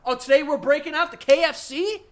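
loud, high, hammed-up food hype; mock excitement. Mukbanger breaking out the KFC, exaggerated excited shout. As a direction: imitate a host gushing over the menu.

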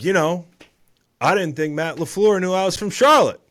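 loud, high-pitched imitation of an athlete's interview; Jaire Alexander. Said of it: "But Jaire Alexander, after the game".

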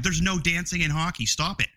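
ordinary talk, nothing marked

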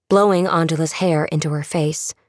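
ordinary delivery

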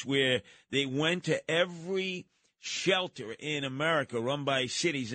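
ordinary delivery

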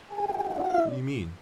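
high, slow, alarmed question; high slow shock; startled question. Acted. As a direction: recoil at what they just said. Creatures talking strangely.

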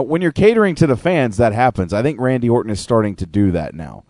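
normal talking voice

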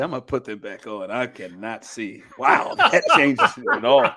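usual speaking voice

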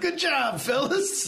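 high-pitched mock congratulations; retold excitement. Falling rider congratulating his knee pads, mock excited. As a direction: praise them in a giddy high voice. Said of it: "just excited they were doing their job".

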